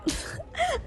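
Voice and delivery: quick high embarrassed giggle; squeaky nervous laugh. Real, not acted. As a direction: giggle at your own blunder.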